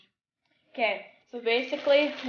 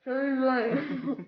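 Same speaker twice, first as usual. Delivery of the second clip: slow, through a mouthful of marshmallows; mouth full; slower than usual. Real, not acted. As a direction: struggle to shape the words. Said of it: "fit 'em in our mouth to be able to say Chubby Bunny".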